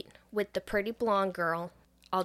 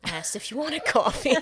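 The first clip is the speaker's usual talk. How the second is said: snippy; 3 semitones higher, 5 dB louder than usual. Retold or imitated. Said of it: "And he's like".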